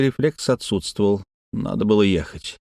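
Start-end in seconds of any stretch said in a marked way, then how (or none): none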